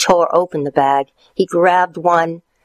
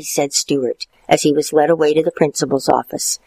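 ordinary delivery

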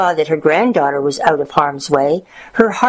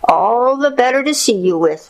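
higher, crooning put-on voice; false granny voice, raised pitch; wolf as grandmother; 7 semitones higher than usual. A narrator's reading. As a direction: croon it to the child as the disguised wolf.

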